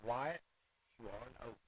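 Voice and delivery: hushed, fading movie-gunslinger impression; Doc Holliday line. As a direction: quote it softly, sinking to almost nothing. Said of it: "My favorite Doc Holliday line".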